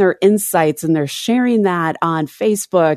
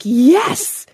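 excited cheer; 7 semitones higher than usual. Retold or imitated. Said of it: "and they're like".

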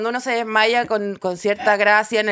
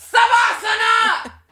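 high, long-held yell; imitated relentless command; shouting instructor; 10 semitones higher, 3 dB louder, much slower than usual. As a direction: bellow the pose name, stretching each syllable. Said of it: "me grita como".

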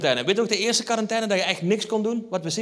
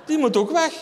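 wife's decree; 10 semitones higher, 4 dB louder than usual. Retold or imitated. Wife declaring wall must go.

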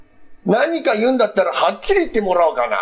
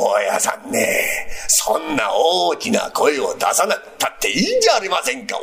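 ordinary delivery

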